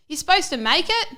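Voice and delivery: loud high questioning shout; calling out. Retold or imitated. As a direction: yell the question excitedly. Calling out in retold poem.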